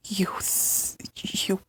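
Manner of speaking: sharp unvoiced whisper, words stalling; barely able to get it out, whispering; broken whisper. Whispered hurt in argument.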